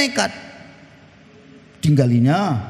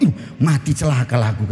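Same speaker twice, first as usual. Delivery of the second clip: mock dread; 10 semitones lower than usual. Husband realises danger.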